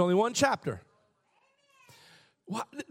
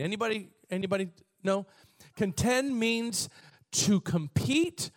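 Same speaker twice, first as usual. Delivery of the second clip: usual speaking voice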